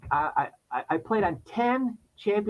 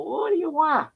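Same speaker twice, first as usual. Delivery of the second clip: mock annoyed homeowner; 14 semitones higher than usual. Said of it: "They open up and go".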